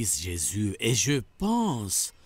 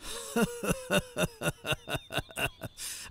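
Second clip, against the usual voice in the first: long gleeful villain laugh; evil laugh; 7 semitones higher, 6 dB quieter than usual. Acted. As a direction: savour the victory. Villain triumphs after arrest. (long rire de triomphe maléfique)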